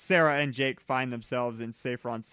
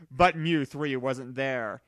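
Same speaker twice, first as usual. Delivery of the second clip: usual speaking voice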